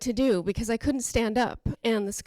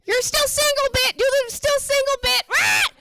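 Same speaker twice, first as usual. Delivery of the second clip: very high, loud parrot screech; shrieking pet bird impression; squawking parrot; 12 semitones higher, 8 dB louder than usual. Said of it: "if I had a helper parrot".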